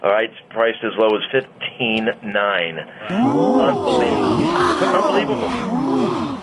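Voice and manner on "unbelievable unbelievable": high, drawn-out mock amazement; oohing and aahing. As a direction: marvel at the deal, stretching the word out high. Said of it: "No wins and wahs on that one?".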